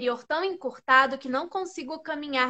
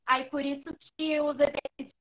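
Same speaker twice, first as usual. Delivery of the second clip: choppy, dropping internet audio; connection breaking up. Real, not acted. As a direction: voice cutting out on a bad line. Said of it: "Tá falhando, eu não tô te ouvindo direito".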